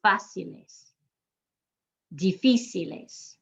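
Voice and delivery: "Fáciles. Difíciles." as FA-si-les, di-FI-si-les; stress on accent mark. Shown as a demonstration. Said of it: "put it where the accent mark is written".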